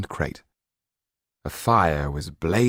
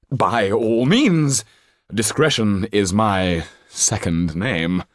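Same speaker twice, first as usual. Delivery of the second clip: much higher and louder, grand stage manner; theatrical old actor; 4 semitones higher, 4 dB louder, slower than usual. Acted. Actor Trippin telling the banker about the boy, theatrical old actor. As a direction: declaim like a hammy actor.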